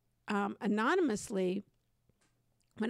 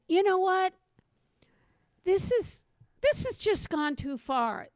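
raised, higher voice, fed up; exasperated outburst; 7 semitones higher, 4 dB louder than usual. Retold or imitated. Telling her partner she's had enough, loud exasperation. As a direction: blurt it out like you've had enough.

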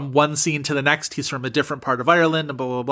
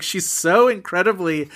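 usual speaking voice

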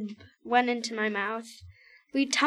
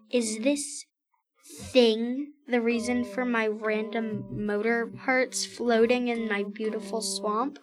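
usual speaking voice

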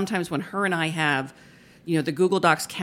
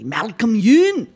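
high, loud, drawn-out bad Scottish accent; 7 semitones higher, 10 dB louder, much slower than usual. Shown as a demonstration. Stage manager teasing her colleague for a laugh, bad Scottish accent. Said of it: "really bad Scottish accents".

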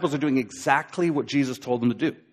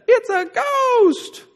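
very high, very slow drawn-out wail; terrified ghost cry; much slower than usual. Retold or imitated. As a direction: stretch it into a spooked, quavering howl. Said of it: "It's probably what they sounded like".

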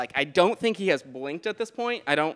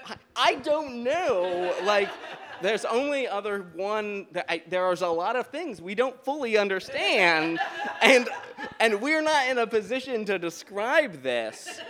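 higher voice, breaking into laughter; flustered laughing; 5 semitones higher than usual. Retold or imitated. Answering the shout from upstairs, flustered and laughing. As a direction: give a mock-helpless answer through laughs.

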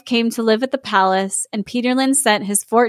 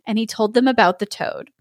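ordinary talk

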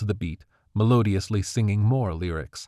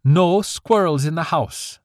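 slow, louder, each word pressed; emphatic; 9 semitones higher, 5 dB louder than usual. A narrator's reading. Mother laying down the rule to her daughter, emphatic. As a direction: lay down the rule firmly. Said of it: "she finished emphatically".

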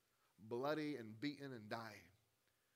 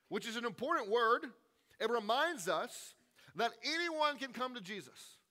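much higher and louder impassioned sermon; preaching at full force; 9 semitones higher, 11 dB louder than usual. Real, not acted. Preacher urging the congregation that anyone can come, at full force. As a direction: preach it hard to the back row.

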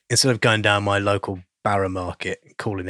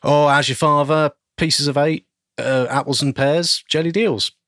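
Cockney lad. Pretend. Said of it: "as a good Cockney lad".

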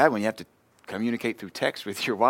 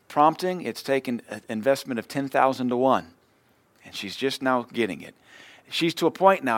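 usual speaking voice

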